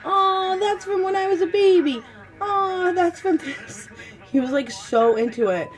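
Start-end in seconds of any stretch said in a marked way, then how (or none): 0.0-3.9 put-on a lightly raised, eager little-boy voice; imitating excited son. Mom retelling her son's ornament chatter, a light excited kid voice. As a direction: mimic him gushing over each ornament.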